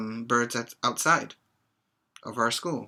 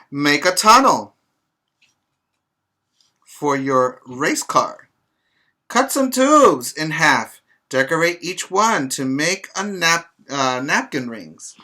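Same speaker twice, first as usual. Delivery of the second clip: bright, louder storybook read-aloud; reading to children; 2 semitones higher, 7 dB louder than usual. Acted. Teacher reading a picture book to her pre-K class, lively read-aloud.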